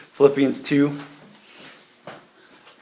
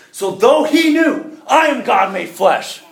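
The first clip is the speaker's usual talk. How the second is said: louder, higher, drawn out; preaching climax; 8 semitones higher, 5 dB louder, slower than usual. Real, not acted. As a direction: lift it up as the peak of the point. Preaching at full force.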